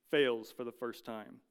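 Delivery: usual speaking voice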